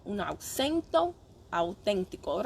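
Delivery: Spanish spoken slowly with a native accent; authentic Spanish accent. Shown as a demonstration. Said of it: "speak with an authentic accent".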